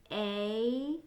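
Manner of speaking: usual speaking voice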